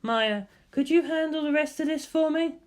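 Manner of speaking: high, cool female voice; lightly voiced cool scientist asking; Ritsuko voice. Scientist asks Misato about Shinji, high female voice.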